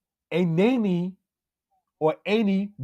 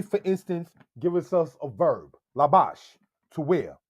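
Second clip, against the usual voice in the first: ordinary talk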